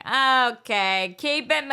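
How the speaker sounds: high, loud impatient driver; mock road rage. Alone in the car at traffic, mock impatient shout. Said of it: "when I'm in my car alone".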